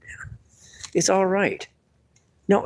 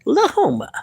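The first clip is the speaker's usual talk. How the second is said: much higher puppet squeak. Acted. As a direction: squeak the name up high. Puppet says Broke Lahoma.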